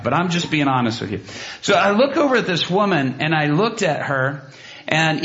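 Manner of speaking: ordinary talk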